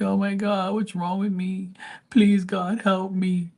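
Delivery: high, pleading mock prayer; squeaky, begging parody of someone praying; mock desperate prayer. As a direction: beg God like a sick hypocrite. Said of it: "when they're sick".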